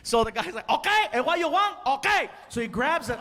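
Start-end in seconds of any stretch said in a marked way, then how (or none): 0.7-2.3 high-pitched mock exasperation, Cuban waiter; pissed-off waiter. Annoyed waiter answers complaining customer, mock pissed-off. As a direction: snap it back as an annoyed waiter, imitated. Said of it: "all pissed off".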